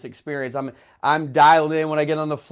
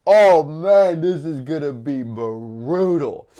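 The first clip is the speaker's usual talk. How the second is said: dramatic dismay; 2 semitones lower, slower than usual. Retold or imitated. Passenger stuck in the worst seat, dismayed. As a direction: draw it out in mock despair.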